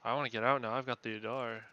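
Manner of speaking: loud urgent outburst. Real, not acted. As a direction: shout it, desperate to leave. Urgent wish to escape.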